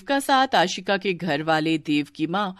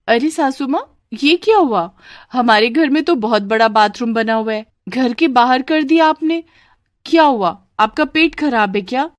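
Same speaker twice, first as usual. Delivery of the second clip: high-pitched, louder incredulous bahu; shocked daughter-in-law; 5 semitones higher, 4 dB louder than usual. Acted. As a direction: question the saas in naive astonishment. (हैरान बहू, ऊँची आवाज़)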